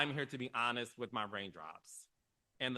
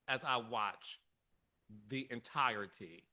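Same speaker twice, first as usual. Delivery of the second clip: ordinary delivery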